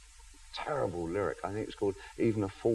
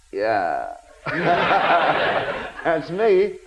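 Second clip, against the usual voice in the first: laughing self-mockery; 8 semitones higher, 8 dB louder, much slower than usual. To the interviewer, laughing at his old self. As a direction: quote himself through a laugh, pitched up.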